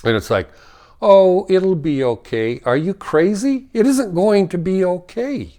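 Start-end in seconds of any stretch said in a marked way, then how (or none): 1.0-2.6 mock reassurance. Mocking people's false reassurance. 2.6-5.5 high, louder, fed-up retort; exasperated outburst. Real, not acted. Ranting to viewers about false reassurance, exasperated. Angry rebuttal of false comfort.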